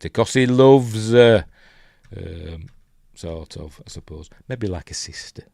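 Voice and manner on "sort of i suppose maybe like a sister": ordinary talk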